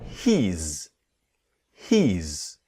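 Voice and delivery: "he's" said as a single syllable, not "he is"; one-syllable "he's". Shown as a demonstration. Teacher demonstrating "he's" as one syllable. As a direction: squeeze "he is" into one beat. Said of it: "one syllable".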